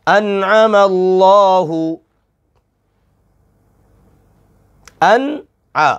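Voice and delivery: very slow chanted verse; slow Quranic recitation. Shown as a demonstration. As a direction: recite melodically, letting words ring. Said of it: "आइन".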